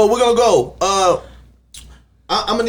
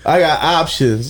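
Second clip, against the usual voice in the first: slow teasing drawl; 3 semitones lower, slower than usual. Acted. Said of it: "I don't like when you say it like that".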